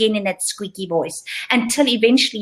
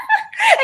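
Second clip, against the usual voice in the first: laughing. Real, not acted. Laughing at own silly voice.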